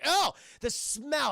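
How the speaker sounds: high, drawn-out loud revulsion; disgusted groan. Acted. Streamer imagining the rotting basement, drawn-out disgust. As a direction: recoil vocally from a stink. Reacts to gross basement stench.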